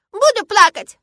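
put-on very high, loud childish wail; defiant crying child. A narrator's reading. Girl refusing to stop crying, tearfully defiant. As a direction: bawl it stubbornly.

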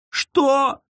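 high frightened squeal. A narrator's reading. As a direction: yelp it in fright. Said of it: "взвизгнула сестра".

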